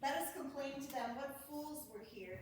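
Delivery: ordinary talk, nothing marked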